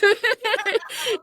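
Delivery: a bright burst of laughter, pitched high; real laugh. Real, not acted. Host laughing at her own joke about guests standing on their backs, real laugh.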